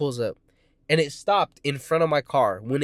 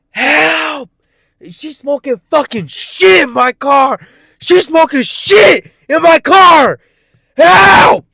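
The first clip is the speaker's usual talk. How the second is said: screaming for help at the top of the lungs; desperate high shouts for help; frantic yelling at passing cars; retold yelling for help; 14 semitones higher, 17 dB louder, slower than usual. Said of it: "I'm out here fucking yelling".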